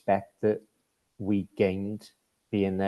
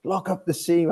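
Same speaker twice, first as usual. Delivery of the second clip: raised, higher-pitched mock yell; mock sideline holler; imitated bench scream; 8 semitones higher than usual. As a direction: mimic a coach yelling from the bench, half strength. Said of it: "Corey Nielsen screaming from the bench".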